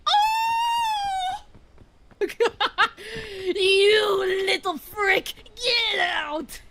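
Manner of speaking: high squealing laugh-yell. Real, not acted. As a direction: shriek it between laughs. Spider attack, laughing yell.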